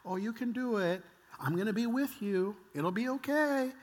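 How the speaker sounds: sweet, lilting encouragement; coaxing reassurance. Acted. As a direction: gently talk a nervous child into it. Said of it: "you kinda turn your approach".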